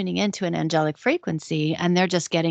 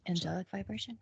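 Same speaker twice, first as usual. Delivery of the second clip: breathy whisper, half to herself; whispered aside; 14 dB quieter, faster than usual. Real, not acted. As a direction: slip the question in under the talk. Aside while others talk.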